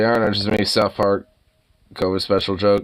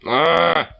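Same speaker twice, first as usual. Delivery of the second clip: sneeze; 8 semitones higher than usual. Real, not acted. Sneeze coming, body seizing.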